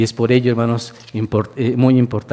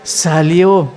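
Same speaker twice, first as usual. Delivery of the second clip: louder, driving sermon voice; fervent preaching; 3 semitones higher, 5 dB louder than usual. Acted. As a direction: preach the miracle to the congregation. Preaches the raising of Lazarus.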